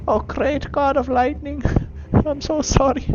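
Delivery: high, pleading Irish voice; Irish accent. Acted. Creature begging the lightning god, Irish accent. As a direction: beg a god for mercy in a high Irish lilt. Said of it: "He's Irish. I don't know why is he Irish".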